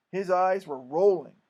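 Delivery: ordinary delivery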